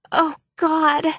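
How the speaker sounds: high, pleading moan. Acted. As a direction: moan it out in fake distress. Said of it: "she moaned".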